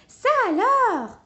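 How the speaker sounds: high, loud, drawn-out "well!"; surprised exclamation. A narrator's reading. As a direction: gasp out the discovery, slowly. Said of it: "s'exclame Plume".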